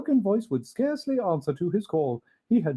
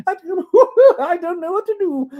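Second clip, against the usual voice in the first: high, loud, giggling through tears; laughing and crying; 14 semitones higher, 11 dB louder, faster than usual. Acted. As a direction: laugh and weep at once. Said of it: "laughing and crying in the same breath".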